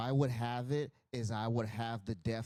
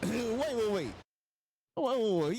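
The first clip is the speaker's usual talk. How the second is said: excited wait; 9 semitones higher, 5 dB louder than usual. Real, not acted. Excited at the ring action.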